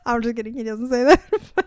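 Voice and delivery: real laughter through quick words; cracking up at your own joke; laughing. Real, not acted.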